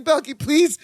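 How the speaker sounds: high-pitched plea without real volume; mock pained scream. Larry begging Balky after being twisted, high mock plea. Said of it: "screams real loud".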